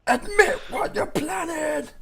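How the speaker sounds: high, slow hammed-up demand; mock interrogation. Player demanding Loghain confess, high slow hammed-up demand. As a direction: bellow it like a crazed accuser.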